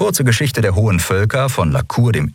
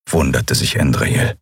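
ordinary delivery

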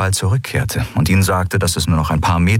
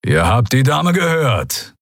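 growling order; 4 semitones higher, slower than usual. A narrator's reading. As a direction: snarl it through the words. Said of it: "knurrte Keru".

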